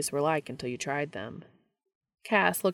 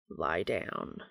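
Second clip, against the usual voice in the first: deep, rough command; rough low voice; 11 semitones lower, 7 dB quieter than usual. A narrator's reading. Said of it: "he said roughly".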